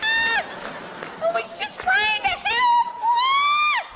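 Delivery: very high, hammed-up pained wailing; victim squealing. Would-be rescuer now grabbed by the neck, comic high shrieking. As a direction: squeal helplessly like the hurt woman, slow and stretched. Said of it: "I'm gonna be going".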